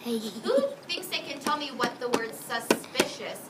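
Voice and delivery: usual speaking voice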